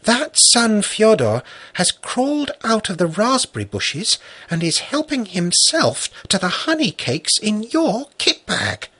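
put-on high child's secretive voice; mysterious whisper. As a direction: confide it like a small girl's secret. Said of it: "in a mysterious whisper".

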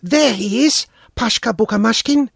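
put-on a boy's voice, lightly held back; hesitant boy. A narrator's reading. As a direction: point him out warily. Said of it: "Sanka said, holding back".

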